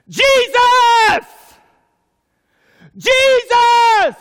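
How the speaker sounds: very high, very loud desperate shout; full-force high yell, last syllable held; screaming at full lungs. Acted. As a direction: cry out over a crowd to be heard. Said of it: "screaming at the top of his lungs".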